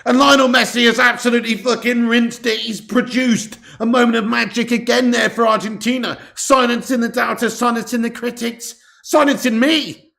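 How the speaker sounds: loud, high-pitched acclaim; excited goal commentary. Real, not acted. Commentator hailing Messi silencing the doubters, high and loud. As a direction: hammer out each phrase in excitement.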